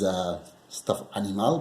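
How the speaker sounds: usual speaking voice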